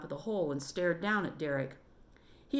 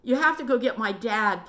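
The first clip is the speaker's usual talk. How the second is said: louder, urgent plea; pleading; 5 semitones higher, 7 dB louder than usual. Acted. Trapped Derek pleads with the boys to fetch his dad. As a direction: beg them to fetch help. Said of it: "pleaded Derek".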